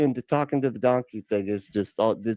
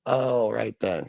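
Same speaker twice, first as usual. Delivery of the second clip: normal talking voice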